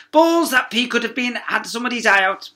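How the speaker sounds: high, loud barking exclamation; outraged shout. Acted. Teacher confiscates marbles, loud high outrage. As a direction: grab them in a fury.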